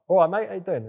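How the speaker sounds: a lightly done Cockney accent from a retold Thai driver. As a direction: hail them like a London geezer from a van. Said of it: "He had, like, a thick Cockney accent".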